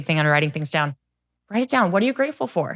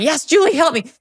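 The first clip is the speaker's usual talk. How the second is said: high, loud eager plea; eager clients; 9 semitones higher, 6 dB louder than usual. Retold or imitated. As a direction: play the clients begging for help. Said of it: "they're all scrambling".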